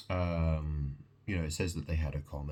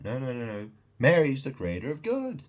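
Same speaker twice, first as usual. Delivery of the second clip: high, loud mock cry of the deceivers; a hammed-up shrieking objection; mocking protest; 7 semitones higher, 7 dB louder than usual. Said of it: "they're basically saying that".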